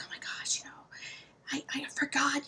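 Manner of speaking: whispered, embarrassed plea to friends; sheepish whisper. Retold or imitated. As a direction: hush it like a secret confession. Retold panic to friends, no supplies.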